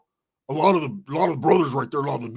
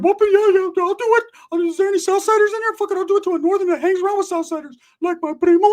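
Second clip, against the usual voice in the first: very high, loud Mickey Mouse voice; Ricky; 6 dB louder than usual. Retold or imitated. Inmate in the background volunteering to do it, high cartoon squeak. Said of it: "that high Mickey Mouse voice".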